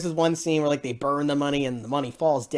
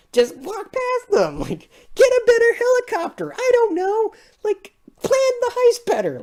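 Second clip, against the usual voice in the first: much higher, squeaky mock whine at the characters; high-pitched disbelief at their stupidity; exasperated mock rant; 4 dB louder than usual. Mocking the film's plot.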